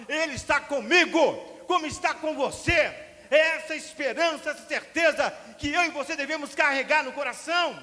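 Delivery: high, loud preaching at full force; fiery preaching. Real, not acted. Preacher exhorting the congregation, loud and fervent. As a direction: thunder out the promise to the church.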